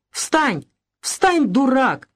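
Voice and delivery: slow, high angry command; angry shout. A narrator's reading. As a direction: bark at him to get up, slowly. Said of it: "закричал он на Ивана". (медленный высокий гневный окрик)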